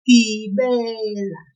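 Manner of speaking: Gibela said slowly, the "be" held long; pulled second syllable. Shown as a demonstration. Teacher repeating it for learners to hear, Gibela with a stretched "be". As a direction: linger on "be" in Gibela, then a short "la". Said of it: "like this. Listen".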